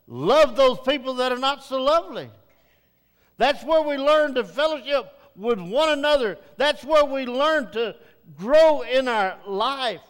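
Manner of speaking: full preaching. Real, not acted. Pastor urging the congregation to join Bible study, preaching loudly. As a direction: drive the invitation home from the pulpit.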